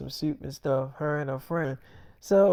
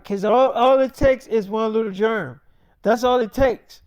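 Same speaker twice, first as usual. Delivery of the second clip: put-on higher, louder girlfriend fretting over germs; mock insistent girlfriend; insistent warning; 5 semitones higher, 6 dB louder than usual. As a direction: press the warning emphatically, pitched up. Girlfriend anxiously insisting about germs.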